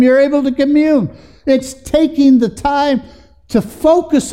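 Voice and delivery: high, loud, fervent preaching; a preacher rising to a loud, fervent climax; preaching at full force. Acted. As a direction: drive it home to the congregation.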